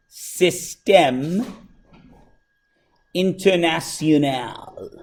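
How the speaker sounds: drawn-out, deliberate French; slow French pronunciation. Shown as a demonstration. Teacher unveiling the French name to class, slowly and deliberately. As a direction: stretch each syllable of the French name.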